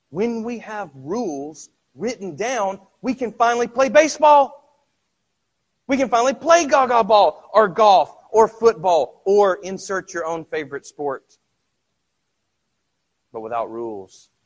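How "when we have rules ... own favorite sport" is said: high, loud, fast impassioned preaching; full-force preaching. Acted. Preacher presses the congregation on inner struggle, loud and fast. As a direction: drive it home to the congregation.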